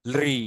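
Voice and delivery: "রি" drawn out after a light l; long lri vowel. Shown as a demonstration. Teacher repeating the long form, drawn-out "রি". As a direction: hold the vowel of l-plus-trill "রি". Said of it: "আর দীর্ঘ হচ্ছে".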